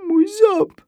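wysoki, płaczliwy głosik dziecka; chlipanie. A narrator's reading. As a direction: zagraj chlipiące dziecko, dużo wyżej i głośniej. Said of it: "chlipnąłem". (po polsku: płaczliwe chlipanie)

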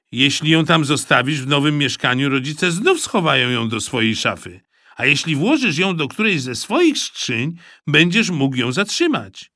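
a clever friend giving advice; a hint of a scheming boy; boy Rufus. A narrator's reading.

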